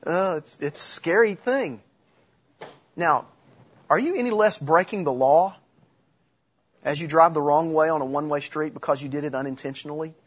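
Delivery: ordinary talk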